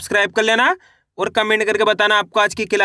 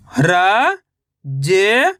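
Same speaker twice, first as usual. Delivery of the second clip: each syllable held long, separated by pauses; much slower than usual. Shown as a demonstration. Teacher sounding out a word for reading learners, syllable by syllable. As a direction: sound out each letter slowly, stretching it. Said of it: "एक एक अक्षर का उच्चारण".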